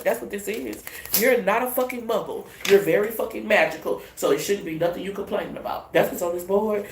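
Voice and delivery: ordinary talk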